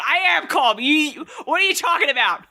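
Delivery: retold indignant yell, pitched high; mock scream. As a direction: shout that you are calm. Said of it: "screamed at somebody".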